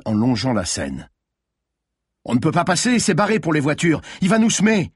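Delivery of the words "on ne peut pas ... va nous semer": high, urgent worry rising to a shout; alarmed protest. A narrator's reading. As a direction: protest urgently, climbing higher. Alarmed protest during car chase.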